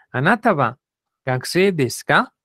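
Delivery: "gakusei desu ka" con la voz subiendo en "ka"; entonación de pregunta. Shown as a demonstration. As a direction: marca la pregunta subiendo al final. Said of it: "Esa es la entonación de pregunta en japonés". (entonación interrogativa japonesa, final ascendente)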